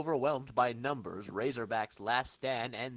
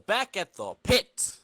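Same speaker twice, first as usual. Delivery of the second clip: slow, louder announcer tone; comic book caption voice; narrator scene shift; 5 semitones higher, 6 dB louder, slower than usual. A narrator's reading. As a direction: announce the location change.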